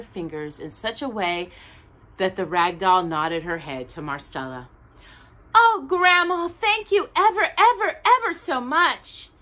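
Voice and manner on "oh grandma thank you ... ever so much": high, loud, gushing thanks; excited cry. A narrator's reading. Marcella thanking Grandma for the doll, high and excited. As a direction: squeal it out like a delighted child. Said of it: "Marcella cried".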